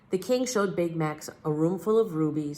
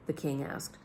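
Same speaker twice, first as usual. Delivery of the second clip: plain reading voice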